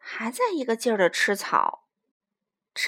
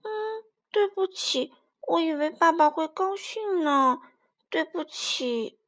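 tearful apology; 5 semitones higher than usual. Acted. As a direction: apologise like a hurt youngster about to cry. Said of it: "不要哭了".

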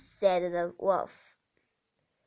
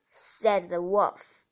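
usual speaking voice

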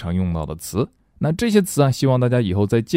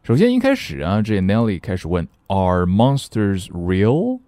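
normal talking voice

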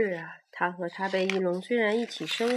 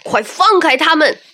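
furious roar, much louder and higher; battle roar; 8 semitones higher, 19 dB louder than usual. Acted. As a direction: bellow it at the attacker. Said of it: "霸王龙怒吼着".

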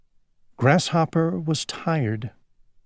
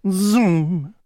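whooshing zoom; 7 semitones higher, 3 dB louder than usual. Acted. Dragonfly flying past sound.

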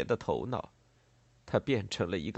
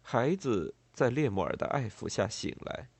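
ordinary delivery

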